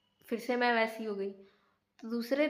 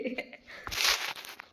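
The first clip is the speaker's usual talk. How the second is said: soft laugh; 4 dB quieter than usual. Real, not acted. Laughing at her own rant.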